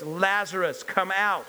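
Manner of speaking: loud calling out. Acted. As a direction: call someone out with authority. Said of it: "called out in a loud voice".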